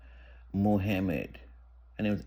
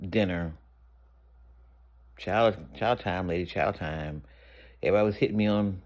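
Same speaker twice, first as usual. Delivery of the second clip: ordinary delivery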